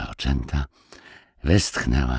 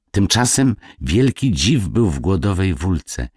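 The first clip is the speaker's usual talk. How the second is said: ordinary delivery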